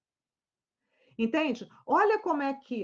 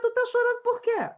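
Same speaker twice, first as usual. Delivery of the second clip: exasperated question; 12 semitones higher, 5 dB louder than usual. Retold or imitated. Exasperated therapist asks again.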